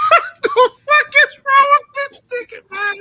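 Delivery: high, loud, slow, through laughter; laughing disbelief. Real, not acted. Host laughing at the unhinged interview, loud laughing shout. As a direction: shout it while cracking up. Repeats question while laughing hard.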